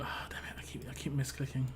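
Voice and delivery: muttered whisper of annoyance; whispered frustration. Real, not acted. Gamer whispering curses at his own misclicks. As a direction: hiss it under your breath, annoyed.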